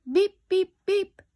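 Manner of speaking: high, loud electronic beeping; robot beeps. A narrator's reading. Robot beeping at the frantic Rabbit. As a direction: beep like a machine. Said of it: "beeped Robot".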